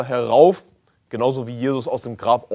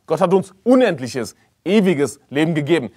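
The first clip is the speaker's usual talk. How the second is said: usual speaking voice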